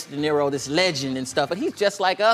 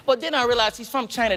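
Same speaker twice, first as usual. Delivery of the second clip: ordinary talk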